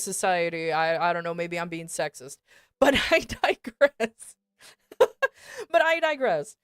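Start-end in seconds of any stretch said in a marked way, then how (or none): 2.8-4.4 slow, laughing aside. Real, not acted. Laughing, then moving on. 5.7-6.6 higher, wheezing laugh through the words; can barely say it for laughing; laughing harder. Real, not acted. Laughing, repeating the aside.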